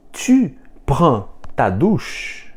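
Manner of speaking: "Tu prends ta douche?" slowly, rising on "douche"; slow teacher's question, voice lifting at the end of "ta douche"; question intonation. Shown as a demonstration. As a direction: make "Tu prends ta douche?" a question by tune alone. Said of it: "mas colocando entonação no final".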